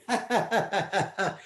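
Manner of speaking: high wicked magician laugh; villain's gloating laugh; evil cackle. Acted. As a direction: cackle over the trapped soldiers. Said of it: "the magician was laughing".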